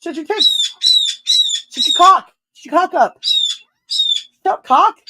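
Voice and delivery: high-pitched yelled outburst; screaming. Real, not acted. Streamer screaming silly insults on a live stream, high yell. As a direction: yell it out in short, high bursts. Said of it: "I was screaming cock really loud".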